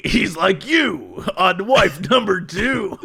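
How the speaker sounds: slow, laughing mock ad line; laughing ad quote. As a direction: crack up mid-quote. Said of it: "I laugh when it's like".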